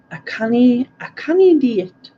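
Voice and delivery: a hammed-up Scottish I canny; high, loud put-on Scottish accent, canny said as kani; mock Scottish accent. Asked to try Scottish, a playful attempt at a Scottish accent. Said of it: "You can start speaking a Scottish accent".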